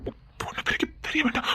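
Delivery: hushed urgent whisper. Acted. Urging Meena not to reveal it in front of him, whispering. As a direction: keep it secret, breathe the words.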